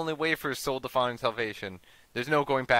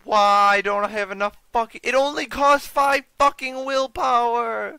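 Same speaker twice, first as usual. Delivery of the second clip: very high, loud complaint; frustrated yelling; 11 semitones higher, 9 dB louder than usual. Real, not acted. Player frustrated at game stat.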